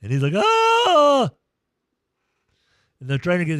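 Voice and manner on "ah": high, loud wail of pain; imitated pained cry. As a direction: cry out like an injured player. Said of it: "you were crying like a".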